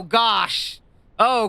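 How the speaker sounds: normal talking voice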